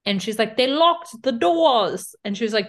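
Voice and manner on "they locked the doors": slow, loud, higher-pitched cartoonish British accent; mock British accent. Podcaster mimicking drunk Casey yelling in a British accent. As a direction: ham up a drunk British yell, dragging it out. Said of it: "trying to do, like, a British door".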